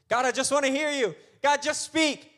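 high, eager plea to God; mock pleading. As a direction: beg like an impatient believer. Acting out a believer begging God.

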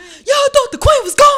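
high, loud, shouted diva boast. Retold or imitated. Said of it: "You know how [name] be".

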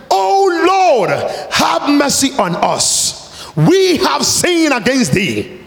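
high, drawn-out plea to God; pleading prayer. Shown as a demonstration. Preacher acting Daniel's prayer for his people, pleading. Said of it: "while he was pleading to God".

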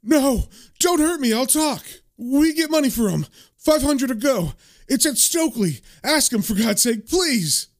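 high, frightened acted begging; panicked pleading. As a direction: plead desperately for mercy. Victim begging under threat.

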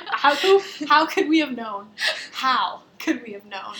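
hammed-up despair through laughter; mock anguish. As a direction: wail it in fake despair.

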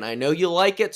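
ordinary delivery

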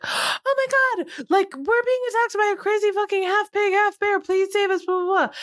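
high, fast mock panic of fleeing girls; panicked girls. Mocking the movie girls begging the truck men for help, high and frantic. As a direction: ham up a frantic, breathless plea. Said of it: "they're like".

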